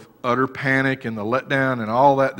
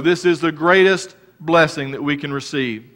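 raised, loud preaching voice; full-force sermon delivery; fervent preaching; 5 semitones higher, 7 dB louder than usual. Acted. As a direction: preach it out to the congregation.

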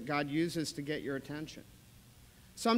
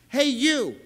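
loud, higher Hey, you; calling out; 7 semitones higher, 12 dB louder than usual. Shown as a demonstration. Jesus calling Simon onto his team, shouting. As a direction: call out to recruit someone. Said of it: "That's what Jesus says".

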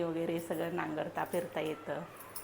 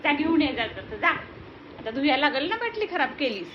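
loud playful orders; loud mock scolding; 7 semitones higher, 7 dB louder than usual. Real, not acted. Sends baby to fetch bottle.

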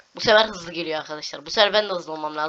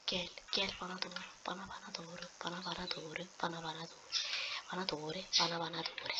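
much softer come to me; whispered coaxing; 15 dB quieter than usual. Real, not acted. Gamer whispering to the character to come, coaxing. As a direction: whisper it like luring a pet.

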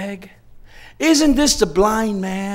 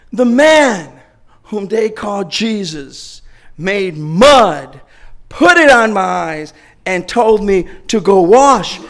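slow, loud, drawn-out testimony; preached testimony; 6 dB louder, much slower than usual. Retold or imitated. Healed man telling the crowd, slow loud testimony. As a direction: preach every word of it.

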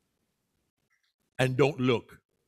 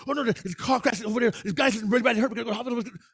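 sudden excited character; 7 semitones higher, much faster than usual. Acted. Excited friend rushes to report a car crash, fast and high. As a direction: blurt it out in a panic. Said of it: "The other's all sudden".

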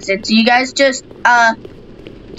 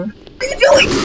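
surprised; 13 semitones higher than usual. Real, not acted. Shocked at teammate.

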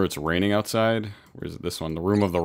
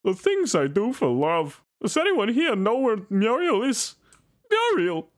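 much higher, slow cartoon voice; Courage dog owner voice; slower than usual. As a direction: call out anxiously for Muriel. Cartoon voice impression.

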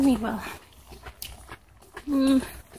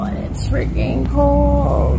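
loud complaint; 3 semitones higher, 8 dB louder, slower than usual. Real, not acted. Cold bus stop complaint.

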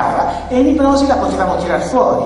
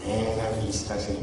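suddenly much softer little voice; dropped tone; 10 semitones lower, 12 dB quieter than usual. Retold or imitated. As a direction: drop the voice low and small, caught out. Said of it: "Abbassa subito il tono". (vocina che abbassa subito il tono, molto più piano)

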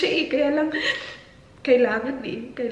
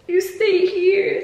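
quoting the daughter; 7 semitones higher, 3 dB louder, slower than usual. Retold or imitated. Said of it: "Sabi niya".

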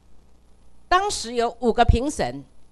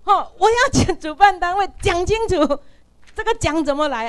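提高嗓门再次质问; raised, higher voice pressing the organizers; retold angry demand; 8 semitones higher, 7 dB louder than usual. Repeating demand again, raised voice. (拉高声音激动质问)

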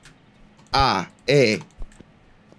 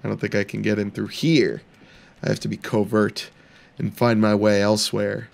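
ordinary talk

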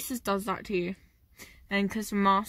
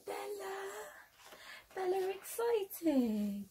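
much higher, slow sing-song to a pet; excited dog talk; 10 semitones higher, 8 dB quieter, slower than usual. Real, not acted. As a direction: hype up the dog, drawn out. Calling and hyping the dog.

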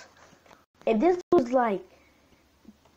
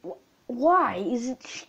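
ordinary talk, nothing marked

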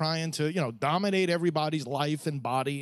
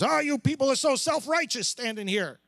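higher-pitched imitation of a scolding heckler; mock accusation; 7 semitones higher than usual. Passerby confronts the rosary leader, accusing. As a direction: throw out a retold accusation, pitched up.

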